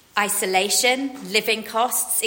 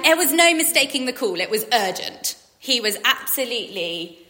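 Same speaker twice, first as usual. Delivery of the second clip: ordinary talk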